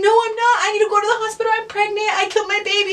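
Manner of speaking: high, frantic re-enacted plea; panicked. Panicked reply to brother, re-enacted.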